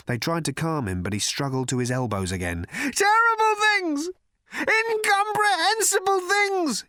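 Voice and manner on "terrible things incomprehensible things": very high, loud, drawn-out shout; frenzied shouting. A narrator's reading. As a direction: shout it wildly, stretching every word. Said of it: "he shouted".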